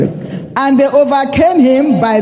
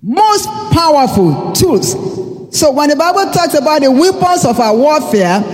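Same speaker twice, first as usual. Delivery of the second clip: preaching; 4 semitones higher than usual. Acted. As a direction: preach it with building fervour. Preaching with rising pitch.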